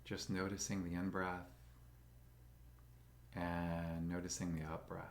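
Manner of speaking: slow, calm meditation guide with long pauses; soothing, even voice, leaving space for breath; guided meditation. Acted. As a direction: guide them gently into stillness, unhurried.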